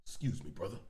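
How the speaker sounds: hushed, breathy excuse me from the bootleg recording; mock whisper. The man crossing the screen in the bootleg, a mock whisper. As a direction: whisper it like someone sneaking past in a theater.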